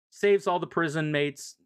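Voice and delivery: ordinary delivery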